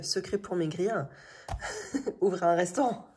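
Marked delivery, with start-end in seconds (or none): 2.2-3.1 laughing. Real, not acted. Joking slimming tip to viewers.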